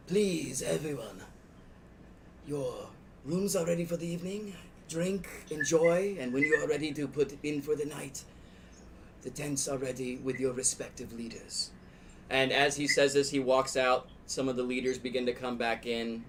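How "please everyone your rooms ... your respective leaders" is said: lightly voiced polite servant; courteous host announcing rooms.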